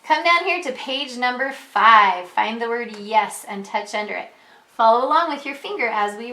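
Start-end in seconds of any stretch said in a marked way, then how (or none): none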